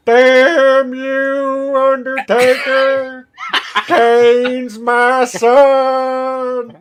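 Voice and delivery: high, slow wailing manager voice; high drawn-out Paul Bearer wail, breaking into laughter; Paul Bearer impression. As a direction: wail it like a spooky old wrestling manager.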